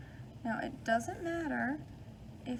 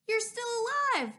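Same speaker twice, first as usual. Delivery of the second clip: high, louder astonished gasp of words; can't believe he made it out; amazed; 11 semitones higher, 6 dB louder, faster than usual. Acted. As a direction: kid amazed to see a friend come back unharmed, astonished. Friends see Arthur return.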